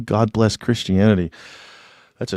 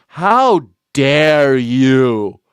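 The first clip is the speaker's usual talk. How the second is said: mock outrage; 5 dB louder, much slower than usual.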